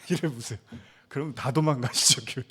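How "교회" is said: usual speaking voice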